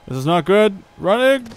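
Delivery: rising panicked shout; bolting away in alarm. Real, not acted. Fleeing monsters in game.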